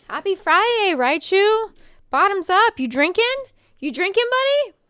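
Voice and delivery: high, loud, cheering greeting; excited cheer. Real, not acted. Greeting a viewer named Raichu on Friday: high, loud cheer. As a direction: hype up a friend.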